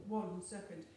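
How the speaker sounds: a hint of storybook beast; Gruffalo voice. Shown as a demonstration. Teacher repeating the answer, low Gruffalo voice. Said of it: "Can you tell me in a Gruffalo voice?".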